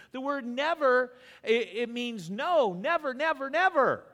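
repeated never climbing to a shout; preaching at full force. Acted. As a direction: pound the word never home.

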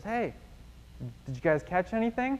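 high-pitched, lightly acted hail; mock distant call without real volume. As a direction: call over to fishermen from the shore. Man calls from the shore.

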